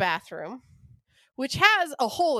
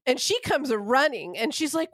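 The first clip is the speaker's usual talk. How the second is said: ordinary talk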